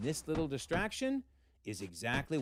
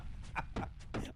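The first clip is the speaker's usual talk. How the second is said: soft laugh; 7 dB quieter than usual. Real, not acted. Vlogger laughing quietly.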